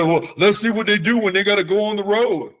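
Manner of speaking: mock a skeptic throwing down a challenge; doubters' challenge. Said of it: "and people say".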